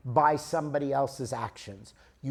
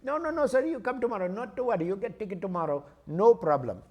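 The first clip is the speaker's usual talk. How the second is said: put-on high-pitched Indian accent, dropping as it goes; Indian ticket clerk; 4 semitones higher, faster than usual. Indian clerk dismisses traveler's worry, Indian accent. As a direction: wave away the worry in an Indian English lilt. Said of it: "So there's this guy".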